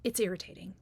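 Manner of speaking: normal talking voice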